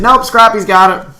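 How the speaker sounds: first sergeant calling down to a sergeant. Retold or imitated. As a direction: sing it out from across the yard. First sergeant calls out again.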